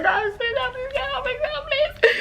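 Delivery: high, frantic cry for help, half laughing; pleading like she's panicking, retold with a laugh; mock panicked plea. Retelling calling for help.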